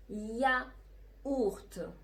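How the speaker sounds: very slow "ياورت", split "ya... ourt"; teacher's drawn-out model of "ياورت"; syllable by syllable. Shown as a demonstration. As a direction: stretch "ياورت" out with a gap inside.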